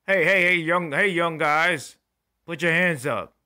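loud barking police commands, high and forceful; shouting cop. Retold or imitated. Police officer yelling at teens to raise hands. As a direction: yell it like a cop pulling up on kids.

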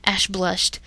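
usual speaking voice

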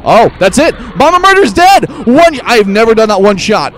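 high, loud shouts of real victory; triumphant shouting. Real, not acted. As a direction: yell out an astonished win. Just beat boss in one try.

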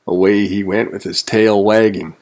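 usual speaking voice

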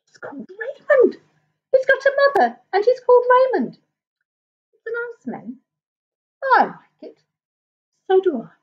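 high, chattering crowd of little animals; high-pitched gossiping creatures; astonished animals. A narrator's reading. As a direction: pipe up in amazed little voices.

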